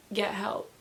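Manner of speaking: normal talking voice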